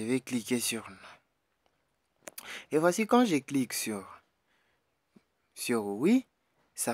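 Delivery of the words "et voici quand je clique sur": usual speaking voice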